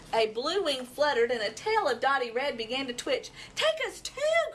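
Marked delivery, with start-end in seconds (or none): 3.5-4.5 high, pleading excited call; a bright owl begging to come along; eager cry. A narrator's reading. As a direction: cry it out as you take off. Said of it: "cried the pretty owl".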